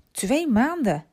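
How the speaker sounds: verbaasd. A narrator's reading. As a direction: exclaim it in concerned disbelief. Dame schrikt van antwoord jongen. (verbaasd en geschrokken)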